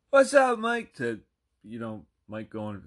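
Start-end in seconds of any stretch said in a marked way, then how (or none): 0.1-0.9 very high, loud mock holler; hyped greeting. Henry greets Mike in the store, imitated as a hyped holler. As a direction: call out with over-the-top enthusiasm. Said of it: "Henry going".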